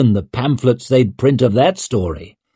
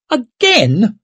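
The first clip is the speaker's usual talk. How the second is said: high, shrill, dismayed; 12 semitones higher, 3 dB louder than usual. A narrator's reading. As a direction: squeak it out in dismay. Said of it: "Leo's voice went shrill with dismay".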